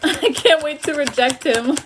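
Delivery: high, loud giggly joke; laughing. Real, not acted. Reader joking to viewers between giggles, high and loud. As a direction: laugh through the line.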